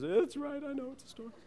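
high, small, squeaky fake-crying voice; hammed-up holding back tears; mock tearful. Father conceding to his son through pretend tears, mock tearful. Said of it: "I wipe away the tears".

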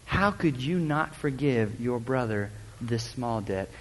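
low, soft, slow rebuke, heavy on wicked; a master holding back his anger at an ungrateful servant; stern master. Retold or imitated. Master condemns the slave who would not forgive, low and stern.

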